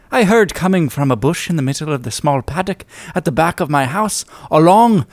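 put-on theatrical Irish storyteller accent; hammed-up Irish narration with dramatic pauses. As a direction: read the old tale in a lilting Irish accent. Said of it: "let me just get my, uh, accent here".

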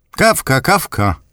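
put-on high old grandmother's voice calling; grandma eagerly calls back for coffee. A narrator's reading. Grandmother calls out to her grandson, high and eager. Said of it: "zawołała".

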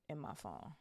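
plain reading voice

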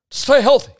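high, loud catchphrase shout. Acted. Podcaster trying out his sign-off as a loud shout. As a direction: belt out "stay healthy" like a slogan. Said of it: "Maybe that's my thing".

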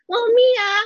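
a daughter hamming up her mother's voice; mom's voice. Retold or imitated. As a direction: play her mom, high-pitched and exaggerated. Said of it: "when I do my mom's voice".

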